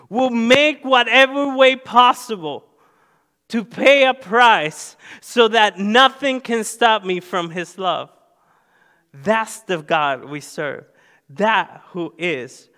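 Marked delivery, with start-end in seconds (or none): 0.1-8.1 loud, higher preaching voice; full-force preaching. Acted. Pastor pressing home God's love, full-force preaching. Preaching continues after quote.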